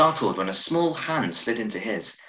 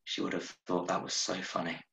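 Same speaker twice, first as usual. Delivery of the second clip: much softer, grieving murmur; 10 dB quieter than usual. Acted. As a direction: let it fall away, barely voiced. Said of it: "Her voice was soft".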